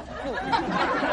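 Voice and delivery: a sudden high airy cackle. Acted. Wife mocking her husband with a high cackle. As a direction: burst into a breathless high laugh at your own joke.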